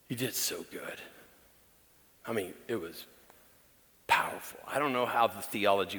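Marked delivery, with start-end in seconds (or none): none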